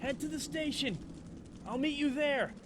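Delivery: distant, softened shouted instructions; shouting directions through the flames; muffled shout. Acted. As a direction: call out from behind the fire. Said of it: "muffled but audible".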